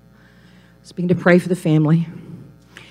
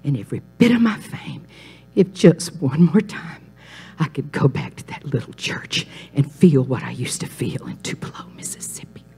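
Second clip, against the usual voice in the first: breathy whisper, much softer, fading out at the end; hushed whisper; 4 semitones lower, 6 dB quieter than usual. Retold or imitated. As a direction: whisper it like a last confession. [name]'s dying wish, quoted reverently.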